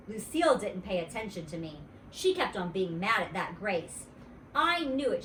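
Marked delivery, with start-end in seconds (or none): 4.5-5.2 put-on grouch it like a jealous kid; sulky grouch; grouchy. A narrator's reading. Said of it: "she grouched".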